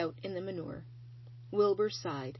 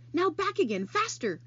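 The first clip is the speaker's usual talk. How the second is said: urging; 10 semitones higher, 5 dB louder than usual. Acted. Charlotte directs Wilbur.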